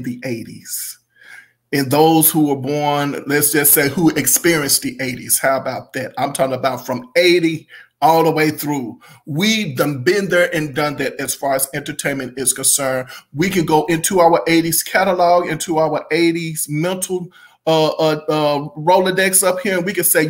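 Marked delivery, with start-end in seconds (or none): none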